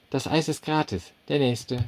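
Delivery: high, brisk waitress brushing him off; curt waitress. A narrator's reading. Waitress waving off the customer, high and curt. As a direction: call the next one in a high voice.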